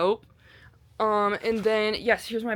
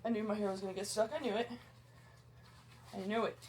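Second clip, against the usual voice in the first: muttered under the breath, much softer; annoyed mutter; 12 dB quieter, faster than usual. Real, not acted. Hiker to herself, hair stuck in the pack, muttering. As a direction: grumble it to yourself, fast.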